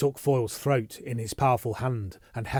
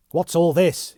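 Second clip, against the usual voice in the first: sharp, loud, higher snap; snapped; 6 semitones higher, 9 dB louder, slower than usual. Acted. Yeovil cutting into the uproar, snapping loud and high. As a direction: snap it out in irritation. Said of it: "he snapped".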